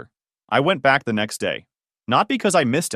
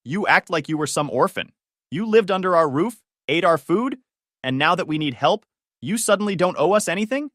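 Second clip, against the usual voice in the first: rising anger; 7 semitones higher than usual. A narrator's reading. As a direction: voice climbing higher and angrier. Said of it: "voice rising fast".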